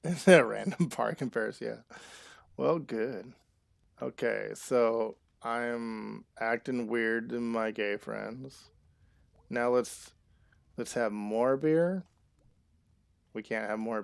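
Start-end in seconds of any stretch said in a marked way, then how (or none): none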